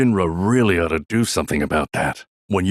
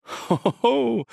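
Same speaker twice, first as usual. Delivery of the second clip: high, gleeful little "ho, ho, ho"; jolly chuckle; slower than usual. Acted. Man admiring his Mako tank, chuckling with glee. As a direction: chuckle with delight at your beloved tank.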